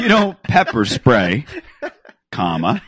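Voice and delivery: slow and deliberate, saying comma; slow spelled-out dictation. Shown as a demonstration. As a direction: dictate slowly with laughter. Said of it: "Let's take this one at a time".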